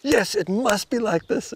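mock earnest voice of the gripped self; insistent inner voice. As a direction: rise up high as if it all matters. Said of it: "And then other times".

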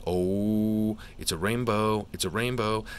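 thrilled at the pull; excited. Real, not acted. Pulls rainbow rare card.

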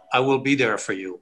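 ordinary talk

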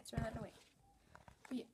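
ordinary delivery

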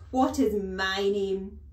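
loud, slow demand; imperious, very loud interrogation; bellowing headmistress. A narrator's reading. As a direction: drill the question into the boy. Headmistress demands her title.

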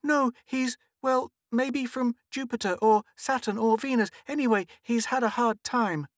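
put-on high-pitched little girl explaining; earnest child. A narrator's reading. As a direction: defend a friend in a high, insistent child voice.

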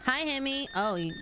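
ordinary talk

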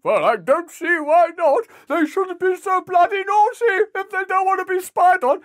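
very high, hammed-up British sitcom voice; British accent. Amazon man agreeing to spy on customers, high silly British accent. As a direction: squeal it as a silly British character. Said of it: "I like that British accent you're doing".

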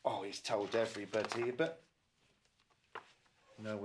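plain reading voice

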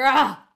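loud, high cry of surprise; startled yell of pain; surprised shout. A narrator's reading. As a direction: cry out as if suddenly stabbed. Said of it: "he shouted, surprised".

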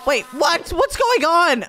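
high, alarmed questioning; startled. Acted. Customer cut off mid-rant by sudden trouble, startled high cry. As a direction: break off and yelp the question.

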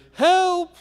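a thin high call from across the world; calling for help. A narrator's reading. Sleeping friend hears the missionary, a high call for help. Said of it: "Murray Brown calling for help".

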